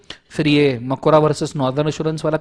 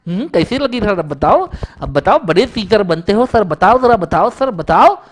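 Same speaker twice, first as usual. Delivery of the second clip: cheeky student taunt; 5 semitones higher, 6 dB louder than usual. Retold or imitated.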